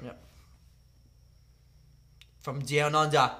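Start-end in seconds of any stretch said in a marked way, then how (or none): 2.4-3.3 put-on Aussie accent; lightly done Australian twang. Player giving an accent clue, Australian accent.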